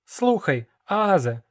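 put-on boy poking fun at the dealer; young apprentice. Hero asks mentor about travel.

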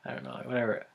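usual speaking voice

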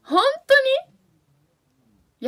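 high, loud disbelief; real astonishment. Real, not acted. Streamer reacts to viewers' dialect revelation, astonished. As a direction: burst out high and loud, truly shocked. Shocked to learn word is dialect.

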